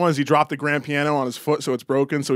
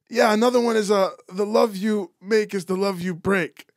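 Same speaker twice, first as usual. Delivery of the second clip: put-on old Portuguese accent; 5 semitones higher than usual. Old Portuguese customer passing off lyrics, accented. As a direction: deliver it as your own lyric, old Portuguese man. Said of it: "doing a perfect accent".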